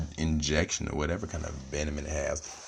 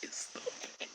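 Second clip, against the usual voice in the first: whispered; 17 dB quieter, slower than usual. Pretend. Playful insult, repeated.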